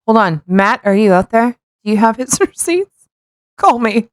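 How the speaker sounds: ordinary talk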